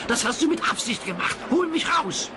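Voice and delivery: high, fast, upset demand; indignant Thomas. A narrator's reading. Thomas accuses Percy and demands rescue, high and upset. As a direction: rush the accusation.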